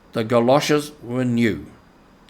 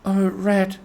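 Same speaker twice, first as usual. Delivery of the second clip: higher, trembling Mole crying out; Mole, scared, crying out to Rat; frightened Mole crying out to Rat; 8 semitones higher than usual. A narrator's reading. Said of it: "he cried".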